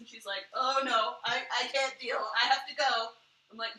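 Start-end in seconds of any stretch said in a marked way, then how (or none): 0.5-3.1 louder, mildly panicked retold protest; mock dismay. Mom refusing to keep watching a scary movie, mock dismay. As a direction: imitate someone begging out.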